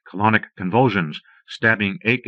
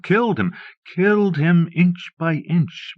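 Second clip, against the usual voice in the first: anguished; 10 semitones higher, slower than usual. A narrator's reading. Nightmare refrain.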